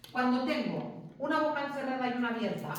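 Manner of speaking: ordinary delivery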